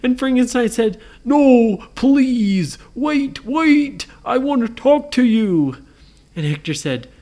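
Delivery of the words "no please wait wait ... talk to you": loud, high plea to stop; acted desperate monster plea; urgent pleading. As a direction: call after someone running off. Frankenstein calls fleeing ghost back.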